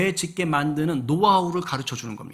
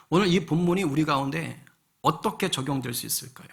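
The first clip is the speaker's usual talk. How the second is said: usual speaking voice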